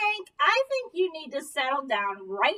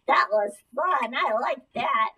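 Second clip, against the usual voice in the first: usual speaking voice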